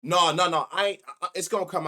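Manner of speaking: loud, panicked "No, no, no" rushing to cut himself off; alarmed shout. Real, not acted. Panicked denial after slip.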